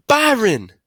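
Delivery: high, loud motherly cry; alarmed cry. Acted. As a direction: call out his name in distress. Mama cries out his name.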